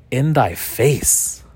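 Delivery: drawn-out "In thy face" in mock archaic style; slow, playful ye-olde delivery; mock archaic speech. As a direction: stretch it out like old-time speech. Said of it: "I have to speak like that".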